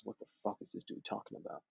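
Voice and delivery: whispered under the breath, thinking to himself; inner-voice whisper. Retold or imitated. New hire secretly baffled by the boss, whispering. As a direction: hush it like a private thought. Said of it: "Still back on the inside parts".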